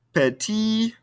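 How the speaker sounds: "Petit" pitched high, ending on the vowel; silent final T. Shown as a demonstration. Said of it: "we don't pronounce the ending consonant".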